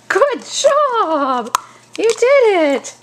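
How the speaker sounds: high, loud praise for the dog; squeaky praising a dog. Real, not acted. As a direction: gush over the dog. Praising dog after fetch.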